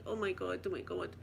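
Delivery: soft, murmured oh my God; hushed oh my God. Retold or imitated. To herself watching the drunk uncle, hushed disbelief. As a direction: mutter it under your breath in shock. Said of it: "like that".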